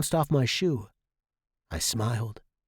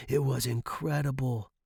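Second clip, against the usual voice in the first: ordinary delivery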